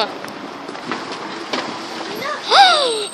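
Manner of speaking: a big, high-pitched gasp of delight; excited gasp. Real, not acted. Walking into Pets at Home, a delighted gasp. Gasps entering the pet shop.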